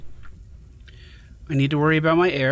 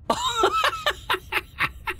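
delighted high laugh; 4 dB quieter than usual. Real, not acted. Delighted by creature.

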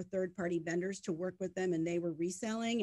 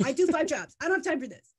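loud, high-pitched mock outburst; exasperated retelling at raised pitch and volume; retold exasperation; 9 semitones higher, 10 dB louder than usual. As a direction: protest it, fed up and much louder. Retold exasperated protest, second take escalates.

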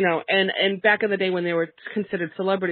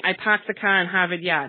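Boston accent with the R's dropped, "paahked the cah in Haavid Yaad"; thick Boston accent, no R sounds at all. Shown as a demonstration. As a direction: say "parked" and "Harvard" the Boston way, R-less. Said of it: "That hurts me to say that".

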